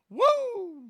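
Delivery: high-pitched woo yell; mock Flair woo.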